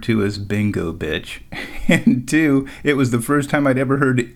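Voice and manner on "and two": usual speaking voice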